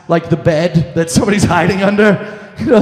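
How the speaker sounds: ordinary talk, nothing marked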